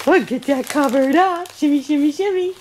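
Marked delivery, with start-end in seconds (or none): none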